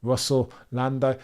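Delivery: ordinary talk, nothing marked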